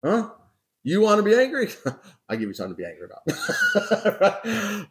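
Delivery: rising, taunting "you wanna be angry?" with a laugh; preacher's playful angry-God impression; mock threat from God. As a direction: dare him, half-joking, half-menacing. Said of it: "if it was me, would say".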